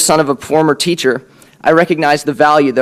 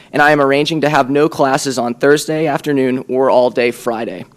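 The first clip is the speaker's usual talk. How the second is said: ordinary talk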